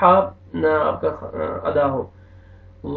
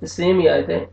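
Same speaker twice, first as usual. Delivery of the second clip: ordinary talk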